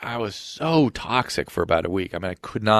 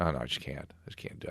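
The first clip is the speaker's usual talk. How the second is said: softer, fading to a drained breath; weary inner voice; 7 semitones lower, 17 dB quieter than usual. Retold or imitated. Recalling his thoughts about running, soft and drained. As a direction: let it die out, worn down. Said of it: "think about running and think".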